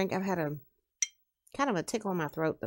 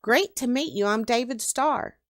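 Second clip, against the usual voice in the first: eager, raised-pitch introduction; higher, louder, friendly enthusiasm; enthused; 5 semitones higher, 4 dB louder than usual. Acted. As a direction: introduce yourself keenly to someone you admire. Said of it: "enthused as he shook Kix's hand".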